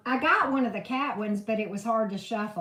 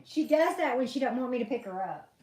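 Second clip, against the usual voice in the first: normal talking voice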